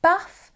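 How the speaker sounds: "bath" with a short a as in cat; short-vowelled northern "bath"; northern English bath. Shown as a demonstration. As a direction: say "bath" as someone from Yorkshire would. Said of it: "They pronounce the word bath".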